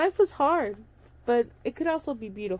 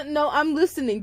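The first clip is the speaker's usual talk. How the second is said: plain reading voice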